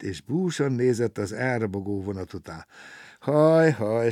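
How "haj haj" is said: sighing. A narrator's reading. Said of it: "sóhajtozott".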